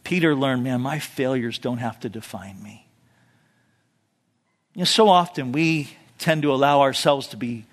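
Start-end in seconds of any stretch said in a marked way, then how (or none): 0.6-2.9 much softer, lower inner realisation; quiet realisation. Retold or imitated. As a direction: say it as a relieved thought. Peter's realisation.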